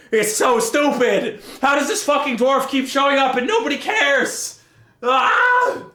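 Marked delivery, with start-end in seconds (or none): 5.0-5.8 short, high, loud cry of frustration; frustrated cry. Real, not acted. Fed-up reader, a short frustrated cry. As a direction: let out an exasperated yell.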